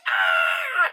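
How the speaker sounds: high, drawn-out startled "ah"; mock scream. Viewer reacts to the stinger jump scare, mock scream. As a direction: imitate a viewer jolted by a loud sting.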